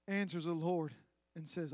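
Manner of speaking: ordinary talk, nothing marked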